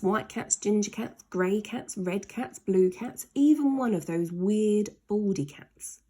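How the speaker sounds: usual speaking voice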